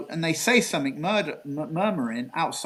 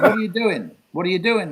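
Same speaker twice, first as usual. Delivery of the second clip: higher, louder retold demand; imitated blunt questioning; 5 semitones higher, 5 dB louder than usual. Gregory on the phone questions the caller, acted blunt demand. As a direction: imitate someone firing a question twice.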